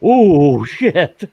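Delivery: mock shocked exclamation. Acted reaction, laughing exclamation.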